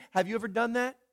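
ordinary talk, nothing marked

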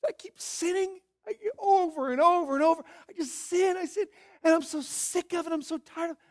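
high-pitched tearful wail, imitated; weepy, high and worn out, put on; mock sobbing. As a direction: act out a sobbing confession. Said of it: "just imagine somebody sobbing".

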